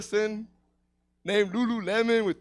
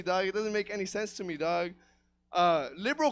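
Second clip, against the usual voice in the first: normal talking voice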